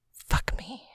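whispered urging, lifting at the end. A narrator's reading. Woman to her lover by the tree, whispering. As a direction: whisper it close to the listener. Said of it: "whispers to him".

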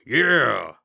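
loud gruff yell; gruff cheer. Acted. Snowflake showing off to Bon Bon, loud gruff whoop. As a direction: shout it, pumping a hoof. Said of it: "gruff, grating voice".

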